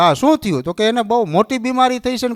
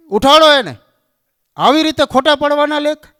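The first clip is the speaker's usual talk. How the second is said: loud, higher angry command; angry demand; 8 semitones higher, 4 dB louder than usual. Retold or imitated. Angry Narad ordering the doorkeeper, loud demand. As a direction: bark the order to wake him. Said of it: "Narad ખીજાણા".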